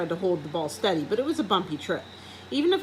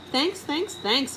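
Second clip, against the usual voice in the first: high, quick polite squeaks; 8 semitones higher, much slower than usual. A narrator's reading. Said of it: "I squeaked politely".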